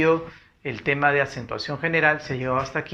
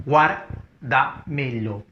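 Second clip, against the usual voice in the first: Guárdamelo split slowly into guár-da-me-lo, stressed on GUÁR; syllable by syllable; much slower than usual. Shown as a demonstration. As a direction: sound out Guárdamelo slowly, a gap after each part. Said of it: "sería el silabente silabeo". (Guárdamelo silabeado muy despacio, con fuerza en GUÁR)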